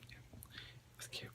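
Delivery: soft whispered aside; whispering. Real, not acted. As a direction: drop to a whisper.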